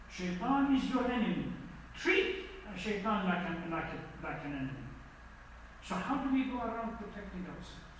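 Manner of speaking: ordinary talk, nothing marked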